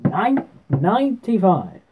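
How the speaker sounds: high, slow, loud darts caller's call; referee voice. Shown as a demonstration. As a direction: stretch the score out like a referee. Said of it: "get our referee voice on".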